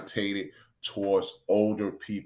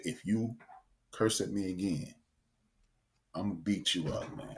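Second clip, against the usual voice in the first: quiet retold threat; 3 semitones lower, 11 dB quieter than usual. Quiet threat to cursing man.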